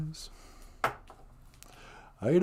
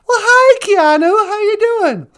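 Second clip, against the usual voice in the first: gushing host; 9 dB louder than usual. Acted. Excited host greets celebrity caller.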